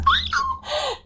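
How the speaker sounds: sharp delighted gasp; flustered gasp. Real, not acted. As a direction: gasp as if caught off guard by praise. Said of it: "y'all are making me blush".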